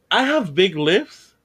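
rising into laughter; laughing. Real, not acted. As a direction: laugh as you say it. Laughing about his lips.

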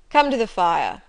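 loud, brusque order; commanding. A narrator's reading. Master summons the governess to the fire, commanding. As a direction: order them over forcefully.

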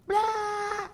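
a high, loud mock blah of disgust; mock disgust noise. Believer waving off the devil, high mock yell. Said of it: "you're gonna go".

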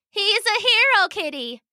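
high little-princess voice, dropping at the end; little girl. A narrator's reading. Little princess praising the cat that saved her, girlish. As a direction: play an admiring young girl.

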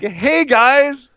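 imitating a smug vacationer; carefree Rusev. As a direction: gloat like you're on holiday. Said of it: "And he's like".

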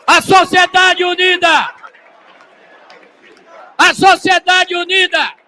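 high, shouted rally chant; rally speech. Real, not acted. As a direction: rouse the crowd, full voice. Leads crowd chant at rally.

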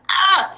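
a sudden loud pained ah; hammed-up yelp of pain; mock body cry. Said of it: "the body's just gonna be like".

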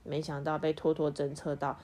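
ordinary delivery

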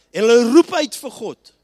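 loud preaching climax; shouted sermon peak; preached shout. Acted. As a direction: call it out to the room.

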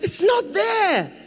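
high, drawn-out exclamation; emphatic denial. Real, not acted. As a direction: call it out slowly to the congregation. Preacher's punchline to congregation.

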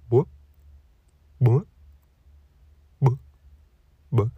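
tiny spaced-out bubble noises; little bubble pops. Acted. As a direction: imitate bubbles surfacing one by one. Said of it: "you hear that little bubble pop up".

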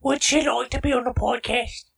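lightly mock British question; a hint of a posh British asker; British accent. Cohost asking in a light mock British accent. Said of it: "speaking in British accents".

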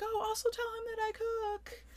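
high-pitched imitation of an eager woman; Monica. Retelling a smitten woman's afterthought to her friend, high and eager. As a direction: call it after her in a squeaky falsetto.

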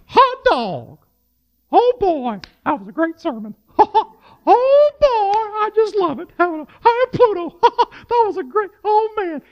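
high, giddy old-timey cartoon voice; vintage cartoon voice. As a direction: gush like a goofy vintage cartoon. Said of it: "every week it's like".

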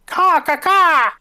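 shrill, loud, high crow caws; cawing bird laugh. A narrator's reading. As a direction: caw like a mocking crow. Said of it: "Laughing in that way that birds laugh".

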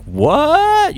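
very high startled what, record scratch; shocked what. Retold or imitated. Dad reading a kids' book, jolted into a high startled squeak. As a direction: jolt up into a high squeak of disbelief. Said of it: "And I'm like".

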